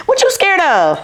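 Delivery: high, put-on voice of a mom asking her scared daughter; mother's voice. Mother asks her pregnant daughter about her fear, high put-on mom voice. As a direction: channel Mama, teasing her grown daughter's fear. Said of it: "I gotta say it in my mother's voice".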